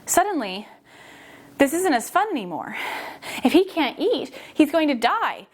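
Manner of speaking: ordinary delivery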